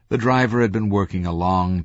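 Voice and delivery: ordinary delivery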